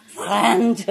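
FRENCH with the R rasped in the throat; throaty French R. Shown as a demonstration. Making fun in a crash course, throaty R. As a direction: exaggerate a gargled R in French. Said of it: "if you pronounce the R".

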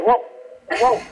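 mild imitation of a dog's call; dog-talk whoa. Shown as a demonstration. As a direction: say it the way the dog does. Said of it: "So I said".

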